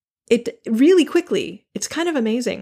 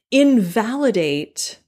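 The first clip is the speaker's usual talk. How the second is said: ordinary delivery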